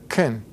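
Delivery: "can" weakened to a low, quick "kun"; unstressed "can", softened to "kun"; reduced schwa. Shown as a demonstration. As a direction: say "can" with the vowel barely there. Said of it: "becomes what we call a schwa".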